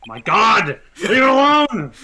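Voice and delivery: loud, high panicked yell; alarmed shout. Acted. Alarmed outburst at costume.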